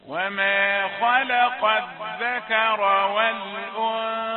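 slow, drawn-out chanted recitation; Quran recitation. Shown as a demonstration. Teacher reciting verses to his students in slow tajweed chant. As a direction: intone the verse slowly and loudly.

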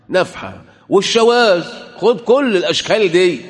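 high, loud fiery sermon; loud, high, impassioned preaching voice; preaching at full force. Real, not acted. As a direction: rail against them from the pulpit. Preaching against the deniers.

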